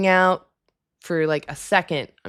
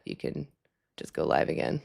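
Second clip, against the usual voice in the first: whisper; 12 semitones lower, 8 dB quieter than usual. Real, not acted. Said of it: "I don't know why I'm talking like that".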